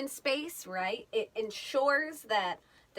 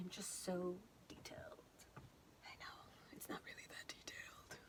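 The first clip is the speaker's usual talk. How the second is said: whispered aside; 3 semitones lower, 18 dB quieter than usual. Real, not acted. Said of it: "unvoiced confiding aside".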